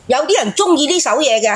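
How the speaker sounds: usual speaking voice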